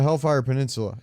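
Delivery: usual speaking voice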